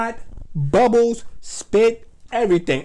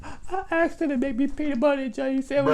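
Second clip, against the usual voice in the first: put-on high, fast child voice telling on sister; high child snitching on his sister; tattling little kid; 7 semitones higher than usual. Boy snitching to mother.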